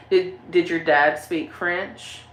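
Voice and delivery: ordinary talk, nothing marked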